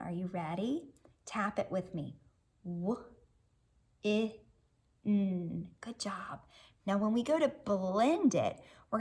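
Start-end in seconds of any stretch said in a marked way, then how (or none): 2.6-5.7 W, I, N, each sound said apart with a pause. Shown as a demonstration. Teacher tapping out each sound for the class, separately. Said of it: "we're gonna say each sound". 5.8-6.4 whispered praise. Real, not acted. Praising child after tapping.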